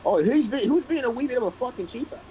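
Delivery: put-on high, brash British accent. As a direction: play a bold British rogue haggling. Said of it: "He's doing an accent".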